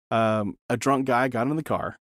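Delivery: ordinary delivery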